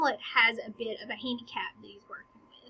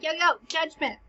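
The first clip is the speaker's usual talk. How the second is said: raised-pitch casual call-out; calling the ref; 5 semitones higher, 4 dB louder than usual. Acted. Hamlet appealing to the ref over a hit, calling out. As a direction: hail the referee, lightly acted. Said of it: "Hamlet calls for the ref".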